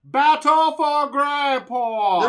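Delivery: loud, high, drawn-out title call; big announcer shout. Pretend. Host plugging a board game to listeners, big announcer shout. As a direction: belt out the title like an arena announcer.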